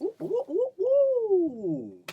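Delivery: very high falsetto whoops; high whooping. Acted. Answering hype chant.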